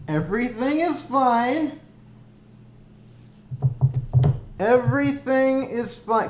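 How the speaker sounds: strained, manic cheer; nervous breakdown. Real, not acted. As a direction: insist it's fine, cracking. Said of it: "by me repeating that everything is fine".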